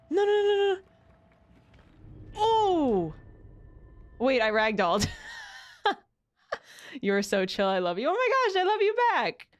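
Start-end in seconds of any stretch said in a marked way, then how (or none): 0.1-3.1 panicked. Real, not acted. Falling in game. 8.1-9.5 excited. Real, not acted. Excited reply to goblin.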